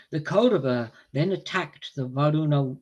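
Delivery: ordinary delivery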